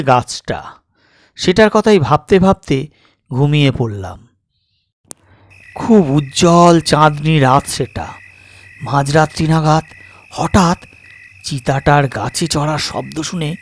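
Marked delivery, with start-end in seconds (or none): none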